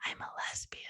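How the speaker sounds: hushed, barely voiced whisper; whispered confession. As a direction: whisper it like a secret. Retelling confiding her secret.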